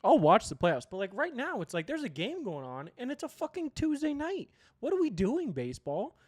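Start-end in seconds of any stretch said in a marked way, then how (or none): 0.0-0.9 louder repeat. Real, not acted. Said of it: "repeated with emphasis".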